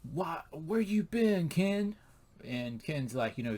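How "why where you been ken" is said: slow, higher-pitched nagging question; lightly acted cross wife; mock mad wife. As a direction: scold an absent husband, drawn out and pitched up. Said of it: "she's all kinda mad at him".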